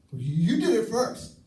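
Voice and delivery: high, loud, indignant accusation; mock spouse snapping back in a quarrel; defensive retort. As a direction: throw the blame back, wounded and loud. Arguing spouse defends themself.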